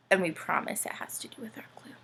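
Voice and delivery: hushed quick secret whisper. Pretend. Whispering a secret promise to the children. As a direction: lean in and whisper the secret fast. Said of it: "secret whisper to audience".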